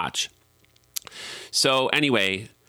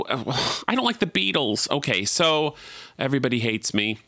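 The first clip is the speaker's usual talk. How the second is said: ordinary delivery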